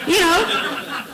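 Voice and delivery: through a real laugh; a real laugh as she tells it. Real, not acted. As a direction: let the laugh carry the words. Laughs remembering the scene.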